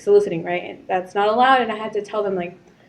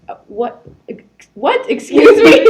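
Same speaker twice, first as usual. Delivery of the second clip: incredulous; 4 semitones higher, 9 dB louder, slower than usual. Retold or imitated. Disbelief at yelling woman.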